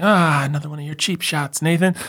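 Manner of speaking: high put-on friend voice; high, teasing friend voice; mock friends' voice. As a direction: tease Nathan in a high put-on voice. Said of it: "they'd be like".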